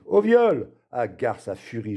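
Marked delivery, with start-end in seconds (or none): 0.0-0.7 high, loud cry for help; sudden high-pitched yell; child's cry of alarm. A narrator's reading. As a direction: shriek out an accusation. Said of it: "La môme pousse un cri".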